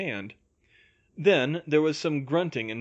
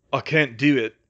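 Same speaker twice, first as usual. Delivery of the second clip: raised, upset call; nearly sobbing; 7 dB louder than usual. A narrator's reading. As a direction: call out, a touch tearful. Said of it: "Harry called".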